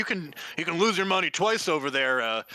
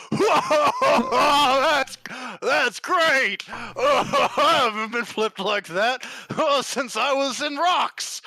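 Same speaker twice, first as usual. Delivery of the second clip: high, loud, delighted big lug; half-orc gushing in a high loud voice; excited brute; 7 semitones higher, 4 dB louder than usual. Acted. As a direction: beam up at them like a thrilled giant. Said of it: "and goes".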